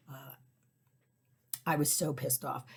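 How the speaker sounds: ordinary talk, nothing marked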